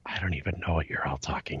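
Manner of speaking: breathy whisper, bewildered; whispered aside. Retold or imitated. Reading Discord chatter she can't follow, whispered. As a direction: whisper it like a confused confession to yourself. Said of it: "and I'm like".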